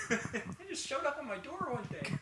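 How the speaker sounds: put-on high cheery old-time TV dad; fifties sitcom dad voice. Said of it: "Is this your fifties sitcom dad voice".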